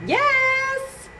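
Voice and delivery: put-on high little kid's yes; child's voice. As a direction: answer like a small boy. Child's answer.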